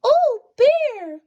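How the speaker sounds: high, loud squeaky mouse voice; a little mouse scolding his friend, high and squeaky. Acted. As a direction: squeak it out like a tiny fussing mouse. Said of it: "Mouse squeaks".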